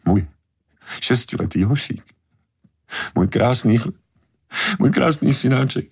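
high, halting lament in broken phrases; distraught mother choking out words; tearful, trembling grief. A narrator's reading. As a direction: mourn the child piece by piece, pitch climbing. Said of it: "Chvěla se vztekem a slzy, které jí tryskaly z očí".

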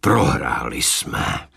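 low, slow prohráli jsme; heavy, slow repeat of prohráli jsme; grim repeat. A narrator's reading. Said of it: "Dělostřelec opakoval".

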